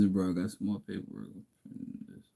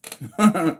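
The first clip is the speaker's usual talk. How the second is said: burst of laughter; 8 semitones higher, 5 dB louder than usual. Real, not acted. Amused at quitting at five.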